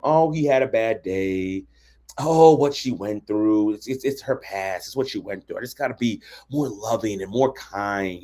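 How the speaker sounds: slow, low, drawn-out mock sympathy; making excuses. Partner excusing bad behavior, mock sympathetic. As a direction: drag out the pitying excuses. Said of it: "start making excuses from".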